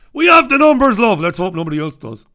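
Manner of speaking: high, loud gleeful whisper-turned-cheer to wife; delighted greedy winner telling his wife. Pretend. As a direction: crow it to the wife, then rush the rest.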